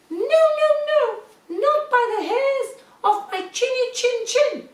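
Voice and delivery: very high, squeaky piggy voice; little pig voice. Acted. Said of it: "And he said".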